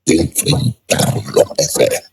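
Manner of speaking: ordinary delivery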